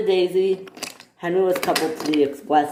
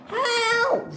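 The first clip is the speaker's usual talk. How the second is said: scream. A narrator's reading. Annie alone on the runaway slope, scream. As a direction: squeal for help way up high. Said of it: "she screamed".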